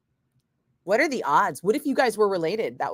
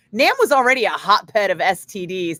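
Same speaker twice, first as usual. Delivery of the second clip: hotbed punched louder; emphasis on hotbed; 6 dB louder than usual. Shown as a demonstration. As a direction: lean hard on hotbed. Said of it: "Emphasis on hotbed".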